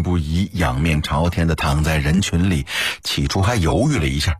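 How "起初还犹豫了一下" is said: ordinary delivery, nothing marked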